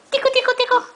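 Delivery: high, loud giggly tickle; giggly tickle attack. Real, not acted. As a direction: squeal it playfully. Tickling toddler again.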